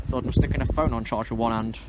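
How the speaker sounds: normal talking voice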